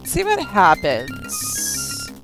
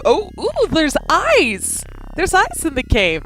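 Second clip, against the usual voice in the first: high, alarmed character voice; startled fright; 10 semitones higher, 4 dB louder, slower than usual. Acted. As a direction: gasp it out in fright. Character spots eyes.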